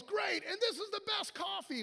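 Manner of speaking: high-pitched gleeful exclamation; excited outburst. Retold or imitated. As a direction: blurt it out like an overjoyed convert. Excited convert blurts out again.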